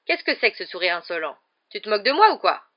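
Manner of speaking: loud, indignant demand; booming angry aunt; thundering. A narrator's reading. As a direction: thunder at an insolent boy. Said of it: "tonna la tante Marge".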